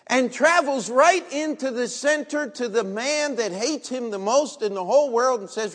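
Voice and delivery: loud, high preaching climax. Acted. Preacher warning his congregation about pride, loud preaching climax. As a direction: drive it home to the congregation.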